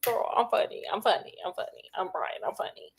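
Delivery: hushed sing-song mockery; mocking whisper. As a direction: whisper it to yourself, mocking his self-praise. Mocking Brian's smugness.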